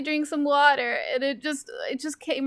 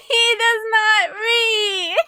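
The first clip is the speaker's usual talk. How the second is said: laughing; 8 semitones higher, 6 dB louder, much slower than usual. Real, not acted. Laughing protest at friend.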